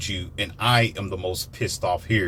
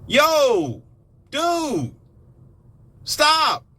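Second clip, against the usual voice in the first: frantic warning shouts; 13 semitones higher, much slower than usual. Retold or imitated. Warning the listeners again, frantic high shouts. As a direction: scream at someone heading for danger. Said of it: "That's all I kept telling you".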